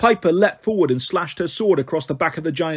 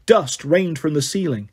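ordinary delivery

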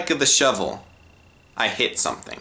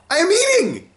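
indignant outburst; 12 semitones higher than usual. Real, not acted. Ranting about ashes at lunch.

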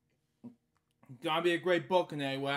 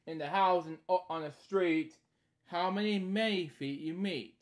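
lively sing-song read-aloud; storybook reading; 4 semitones higher, 3 dB louder than usual. Acted. Reading a bedtime story aloud, lively. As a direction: read each line brightly to a child.

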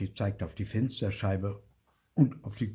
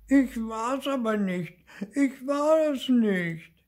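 put-on very high, whiny child voice; protesting little ghost; 5 dB louder, slower than usual. Tiny ghost denies it to Lucy, protesting. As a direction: deny it high and drawn-out.

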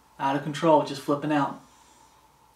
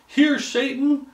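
higher, slow mock handing over; mock offering; 9 semitones higher, much slower than usual. Preacher mocks literally handing someone to Satan, higher and slow. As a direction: lift it high and drag it out as a sarcastic offer. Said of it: "you're not actually saying".

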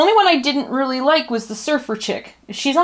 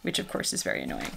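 much lower, softer, fast throwaway; low dry aside; 13 dB quieter, faster than usual. Real, not acted. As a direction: mutter it as a joke. Self-mocking aside.

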